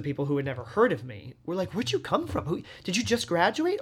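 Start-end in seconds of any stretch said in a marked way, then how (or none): 1.7-3.8 high-pitched nosy questioning; high voice of a clueless admirer; imitated astonishment. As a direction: quiz a newcomer in mock surprise. Imitates people who never heard of them.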